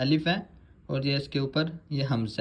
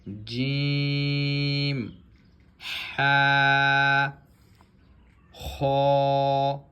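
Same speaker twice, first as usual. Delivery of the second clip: slow, drawn-out letter drill; measured Arabic letter recitation; Qaida recitation; 4 dB louder, much slower than usual. Shown as a demonstration. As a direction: recite each letter clearly like a Quran teacher.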